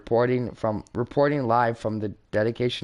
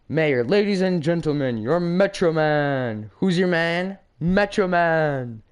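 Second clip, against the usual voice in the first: stage announcer; 6 semitones higher, 3 dB louder, slower than usual. Acted. Introducing Metro Man on stage.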